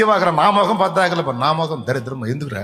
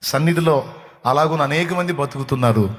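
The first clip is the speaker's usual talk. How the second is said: normal talking voice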